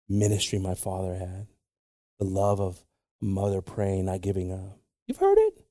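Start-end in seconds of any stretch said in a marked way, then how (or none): 5.1-5.6 sudden high exclamation. Real, not acted. Preacher calling to the congregation, high. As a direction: jump way up in pitch.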